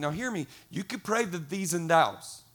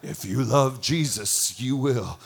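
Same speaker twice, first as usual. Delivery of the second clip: low, slow, weighty put-on voice; mock guilt trip; 3 semitones lower, 4 dB louder, slower than usual. Manipulative appeal to get people serving, mock solemn. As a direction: lay on the guilt, deep and deliberate.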